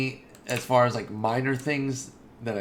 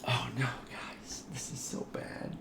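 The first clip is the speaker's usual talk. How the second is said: slow, breath-only whisper of dread; hushed, helpless whisper; despairing whisper; 9 dB quieter, slower than usual. Real, not acted. Hiccups return, near whisper.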